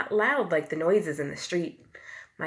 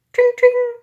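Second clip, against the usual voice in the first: very high loud ting; bicycle bell; 14 dB louder than usual. A narrator's reading. Teacher imitates a bicycle bell, high and loud. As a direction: ring it out like a bell. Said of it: "A bicycle bell ringing".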